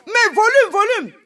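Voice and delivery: high, insistent call. Pretend. Demanding more volume. (réclamer du volume, voix aiguë)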